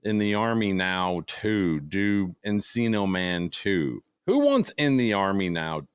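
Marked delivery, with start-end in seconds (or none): 0.0-4.0 slow, drawn-out fan request; slow mocking fan. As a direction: drag each request out mockingly. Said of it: "all of his fans are commenting and saying".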